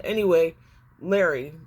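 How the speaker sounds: usual speaking voice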